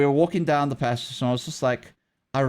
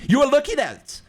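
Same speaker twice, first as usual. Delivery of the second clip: higher, louder mock yell of an accusing teacher; retold shout, much higher and louder, pointing the finger; imitating angry teacher; 11 semitones higher, 5 dB louder, faster than usual. Teacher accusing child over chocolate.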